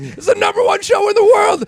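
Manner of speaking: very high, loud outraged protest; indignant Jerry. Retold or imitated. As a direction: insist in mock fury it's the top show. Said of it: "It's like".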